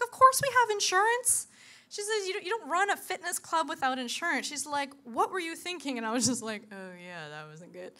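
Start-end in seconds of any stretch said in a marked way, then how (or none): 0.0-6.0 much higher, louder scolding boss; incredulous manager. Retold or imitated. Fitness manager scolding the instructor, high and loud. 6.7-7.9 softer, lower, caught out; sheepish admission. Retold or imitated. Answering the manager, sheepish and soft.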